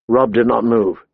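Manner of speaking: ordinary delivery, nothing marked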